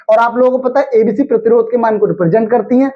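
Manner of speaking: ordinary delivery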